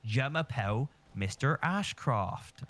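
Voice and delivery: slow, broad Bristolian French; imitating a Bristolian French teacher, drawn out; Bristolian accent. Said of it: "incredibly Bristolian teacher".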